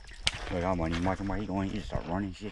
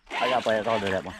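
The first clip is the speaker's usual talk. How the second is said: normal talking voice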